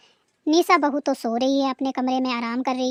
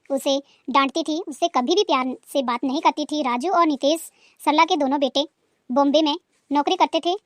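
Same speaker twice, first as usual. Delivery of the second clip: normal talking voice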